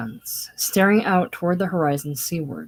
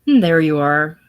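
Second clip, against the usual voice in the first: cheerful; 8 dB louder than usual. Acted. Setting child down.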